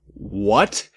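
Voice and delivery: ordinary talk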